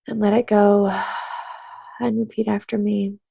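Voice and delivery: soothing meditation-teacher cadence; guided tapping meditation. Acted. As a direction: calmly guide them to tap and breathe.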